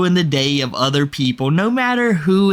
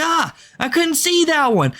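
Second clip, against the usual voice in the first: mock high-pitched Andrew; 11 semitones higher than usual. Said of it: "He's like".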